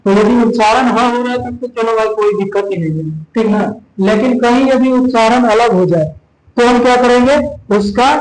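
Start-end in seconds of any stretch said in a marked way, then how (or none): none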